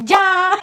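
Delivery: "Ja" said high, without the final n; imitating her high-pitched "Ja"; drawn-out name. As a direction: stretch "Ja" up high, dropping the ending. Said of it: "when she says his name to you".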